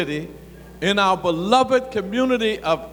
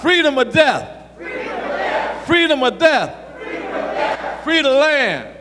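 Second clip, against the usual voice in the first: roaring rallying speech; 3 semitones higher than usual. Acted. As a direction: lead the crowd in a shouted chant.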